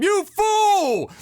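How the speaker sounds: full-voiced, high mock scream. Cohost imitating a comedian on a game show, loud high scream. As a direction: scream it high, drawing out the last word. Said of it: "he'd scream".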